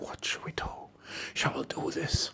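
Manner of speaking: very hushed, breathy whisper; whispered consultation. Acted. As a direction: confer secretly with your spouse. Couple confer privately before doctor.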